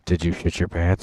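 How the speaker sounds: loud teasing shout; loud blurt. Real, not acted. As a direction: blurt it over everyone. Blurting a joke over the story.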